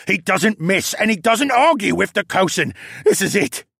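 high, loud, panicky captive voice; frightened captive Luca. Acted. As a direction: voice a prisoner sure he will be killed.

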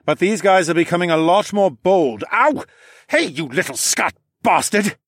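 loud, pained, cursing; angry yell. Acted. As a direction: yelp then curse at it. Horace bitten by a screecher.

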